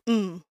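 Mm as a closed-lip hum; short sustained Mm hum; syllabic M demonstrated. Shown as a demonstration. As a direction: hum Mm with no vowel. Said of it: "you pronounce it like".

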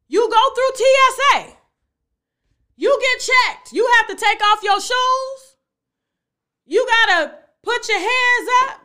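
loud, high, insistent listing; emphatic disbelief. Real, not acted. Picking apart the sleep-travel story, loudly incredulous.

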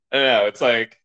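usual speaking voice

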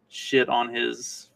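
ordinary talk, nothing marked

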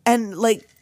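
ordinary delivery

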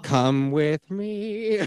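slow, drawn-out mysterious invitation; Willy Wonka impression. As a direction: beckon them in like a whimsical candy maker. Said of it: "like I'm asking Willy fucking Wonka".

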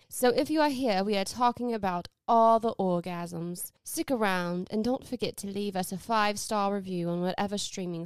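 prim and proper British podcast intro; put-on British accent. Host welcoming listeners to the book podcast, prim British accent. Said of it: "I use the British accent".

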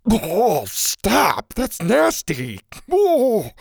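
high, grossed-out gagging cries; gagging in disgust. A narrator's reading. Boy hearing about raw crickets, gagging. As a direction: drag out the words as if about to retch. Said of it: "Bo started to gag".

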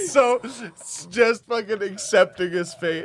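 high, loud, laughing through it. Real, not acted. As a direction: gasp words between laughs. Laughing at weak bison.

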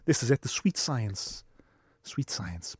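slow, soft, awed murmur; reverent hush. Retold or imitated. Fan back at the sparring, admiring it in a hushed voice. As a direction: savour the beauty of the sparring, hushed. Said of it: "you go".

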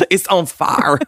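high laugh into the words. Real, not acted. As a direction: crack up while saying it. Laughing while retelling excuse.

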